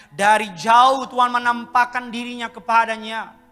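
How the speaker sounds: loud, ringing scripture proclamation; the preacher quoting God's promise, proclaimed loud; preaching proclamation. Acted. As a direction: declare the verse to the whole hall. Proclaims verse with force.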